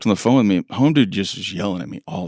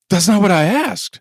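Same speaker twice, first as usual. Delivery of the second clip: higher, louder, exasperated correction; exasperated boss; 10 semitones higher, 4 dB louder than usual. Acted. Boss correcting an employee about the waiver, exasperated. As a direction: snap back in exasperation. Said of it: "I emailed Rebecca about it".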